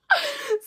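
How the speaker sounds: a high burst of laughter, cringing; embarrassed laugh. Real, not acted. Embarrassed at friend's shout.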